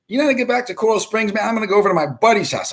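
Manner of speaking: put-on retelling a drunk friend's phone question in a high voice; imitating the friend. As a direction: mimic him asking for directions, fast and high. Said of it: "he's like".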